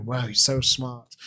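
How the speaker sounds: a lightly played dazzled listener; mock awe again. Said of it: "and you say".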